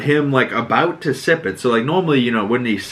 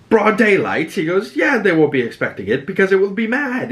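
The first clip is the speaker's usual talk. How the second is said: mock-incredulous, pitched much higher; 10 semitones higher than usual. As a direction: raise the pitch high in disbelief. Knights arguing over battle plan.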